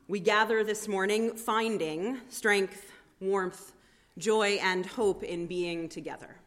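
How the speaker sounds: strong, projected pulpit voice; formal sermon delivery. Acted. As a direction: proclaim it to the congregation. Preaching welcome words at full force.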